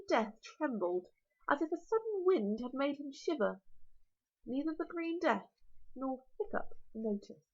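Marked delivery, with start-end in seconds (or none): none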